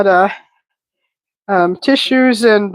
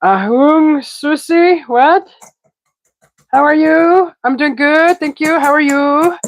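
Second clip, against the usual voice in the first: bright, higher cheerful greeting; upbeat greeting; 8 semitones higher, slower than usual. Real, not acted. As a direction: greet the chat with energy. Greeting chat viewers.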